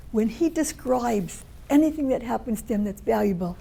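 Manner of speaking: ordinary talk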